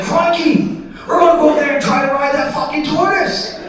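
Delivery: high, excited hammed-up Aussie; a big Aussie "crikey" way up in pitch; Australian daredevil. Comic playing an Aussie daredevil eyeing a tortoise, high hammed-up accent. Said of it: "he's like".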